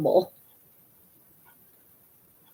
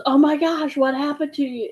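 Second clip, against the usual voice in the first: higher-pitched, mock-alarmed question; retold alarm, pitch raised; alarmed brother; 6 semitones higher than usual. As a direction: voice a brother's shocked reaction. Retelling brother's alarm at fake wound.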